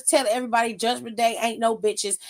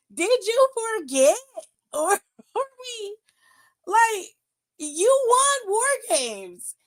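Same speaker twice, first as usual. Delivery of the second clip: incredulous; 9 semitones higher than usual. Real, not acted. Laughing disbelief at wrestler.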